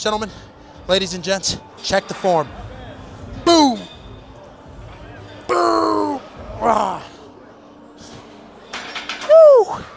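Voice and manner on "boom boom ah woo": high, loud victory cries; triumphant shout. Real, not acted. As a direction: celebrate the last rep. Finishing the set.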